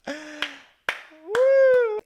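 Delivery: 1 excited whoop. Real, not acted. Thrilled reaction to the story.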